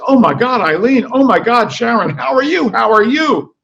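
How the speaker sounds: high, loud effusive greeting; shrieking society greeting; gushing greeting. Acted. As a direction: pour on the delight. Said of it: "over and over".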